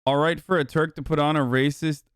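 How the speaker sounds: normal talking voice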